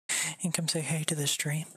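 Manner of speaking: whispered coaxing to the dog. Real, not acted. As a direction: hush it to the sleepy dog. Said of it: "repeated after normal take".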